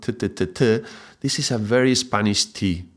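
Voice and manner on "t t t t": "t" with the tongue against the front teeth; dental t. Shown as a demonstration. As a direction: tap out a Spanish "t" on the teeth. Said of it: "the teeth in the front".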